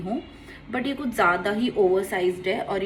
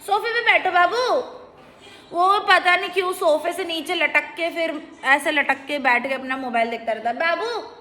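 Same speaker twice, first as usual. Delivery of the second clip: coaxing motherese; 7 semitones higher than usual. Real, not acted. Mother asks Bittu to sit on the sofa, high coaxing sing-song. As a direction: lift the voice and coax him gently.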